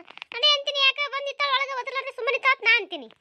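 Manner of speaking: put-on high, loud scolding old woman's voice; high-pitched village auntie, loudly chiding; scolding neighbour woman. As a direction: call her out for standing there silent.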